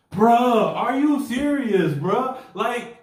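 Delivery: high, loud mock alarm; exasperated retold outburst. Urging a coworker to drop his girlfriend, comic exasperation.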